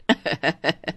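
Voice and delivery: short low chuckle; mother-in-law's chuckle. Acted. Mother-in-law amused by the bride's joke, chuckling. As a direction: chuckle in character.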